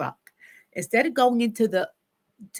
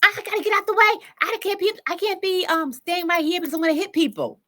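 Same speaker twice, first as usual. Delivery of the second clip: other driver; 9 semitones higher, 3 dB louder than usual. Retold or imitated. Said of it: "and says".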